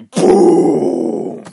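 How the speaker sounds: shouted explosion noise; loud boom. Acted. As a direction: blast out a gunshot sound. Gunshot sound effect.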